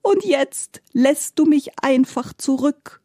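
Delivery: high, slow wailing complaint; tearful-sounding theatrical lament, pitched high; sobbing reproach. A narrator's reading. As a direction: whine the reproach, drawing out the words. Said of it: "schluchzte die Gestalt".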